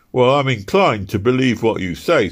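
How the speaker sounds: loud, high gruff constable voice; a stern London bobby challenging him; london policeman. Acted. Policeman voice in story.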